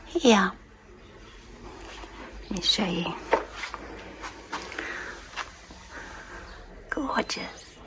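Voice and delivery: soft voiceless whisper; laryngitis whisper. Real, not acted. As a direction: whisper gently, no voice. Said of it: "You don't have to whisper".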